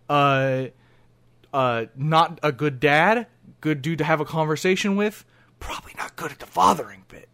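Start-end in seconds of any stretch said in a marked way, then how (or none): 5.6-7.3 whispered aside. Real, not acted. Aside about dad's faults.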